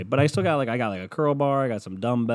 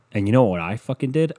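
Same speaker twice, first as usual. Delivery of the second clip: ordinary delivery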